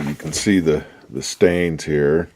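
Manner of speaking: usual speaking voice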